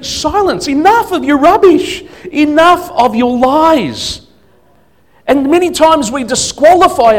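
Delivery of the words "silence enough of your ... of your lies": much higher, louder stern command; commanding rebuke. Pretend. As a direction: order the old self to be silent. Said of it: "You need to tell that you".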